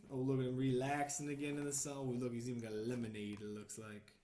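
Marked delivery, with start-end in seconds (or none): none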